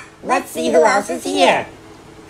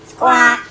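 parrot squawk; 4 dB louder than usual. Acted.

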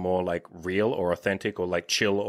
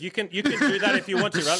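laughing; 9 semitones higher, 4 dB louder than usual. Real, not acted. Joking about silly pickup lines.

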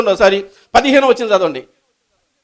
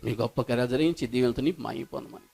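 low, soft praying voice over music; closing prayer; 10 semitones lower, 4 dB quieter than usual. Acted. Pastor praying over the congregation, low and soft. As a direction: pray softly, swelling briefly, then hushed.

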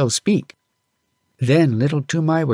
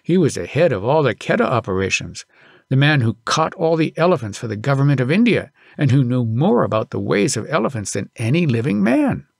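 usual speaking voice

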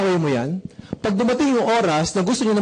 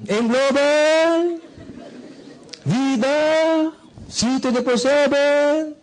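high, slow, drawn-out shouts; shouted pitch; 7 semitones higher, much slower than usual. Shown as a demonstration. Speaker showing recruits how to shout their brand name. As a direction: call it out like a street vendor. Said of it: "Sigaw ka lang".

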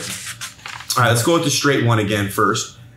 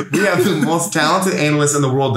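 laughing; 3 semitones higher than usual. Real, not acted. Retry while laughing.

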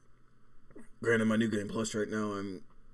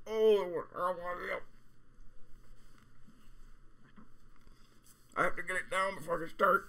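high-pitched strained voice; 9 semitones higher than usual. Real, not acted. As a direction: squeak the words out in real discomfort. Eating something painfully hot.

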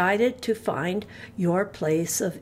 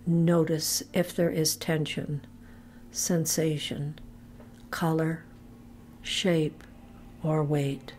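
calm, measured meditation voice with long pauses; low-key, evenly paced body-scan narration; guided relaxation; 3 semitones lower, 4 dB quieter than usual. Real, not acted. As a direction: guide them gently into letting go, phrase by phrase. Leads progressive relaxation meditation.

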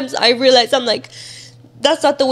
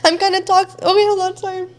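crying; 7 semitones higher than usual. Real, not acted. Pulling herself together for the interviewer, crying. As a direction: apologize while still crying. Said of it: "There's napkins si quieres ir".

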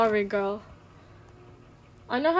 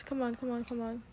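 usual speaking voice

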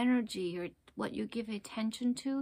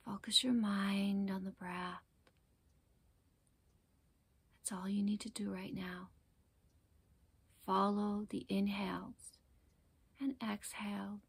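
guided meditation; 8 dB quieter than usual. Acted. Teacher guiding listeners to focus on the breath, calm meditation voice. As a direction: ease them gently into their breath, unhurried.

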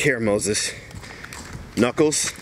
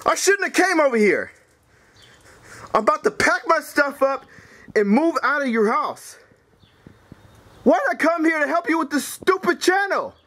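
high-pitched furious complaint; fed-up, ranting at the top of the voice; raging rant; 7 semitones higher than usual. Acted. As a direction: rant as if about to storm out. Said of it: "Knuckles, you lost your mind".

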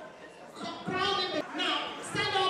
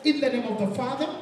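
lower, intoned prayer over the couple; blessing prayer; 5 semitones lower, faster than usual. Acted. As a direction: bless them like a pastor.

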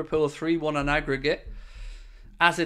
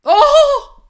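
very high, loud cry at a near miss; real explosive "oh!" from a football fan; loud shout of "oh"; 16 dB louder than usual. Real, not acted. Watching Darwin nearly score, a loud excited cry.

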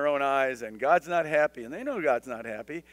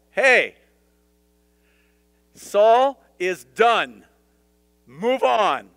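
much louder and higher, very slow on "Saul is done"; forceful divine command; 4 semitones higher, 10 dB louder, much slower than usual. Retold or imitated. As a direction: proclaim it with finality. God voiced to Samuel.

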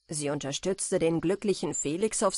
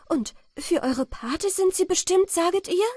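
high, loud incredulous question; startled, urgent questioning; astonished exclamation; 12 semitones higher, 6 dB louder than usual. A narrator's reading. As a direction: press for confirmation, voice lifted high. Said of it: "rief sie".

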